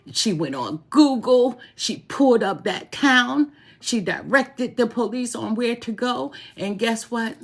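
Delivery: proud mother's excited rush. Real, not acted. Excited telling of daughter's feat.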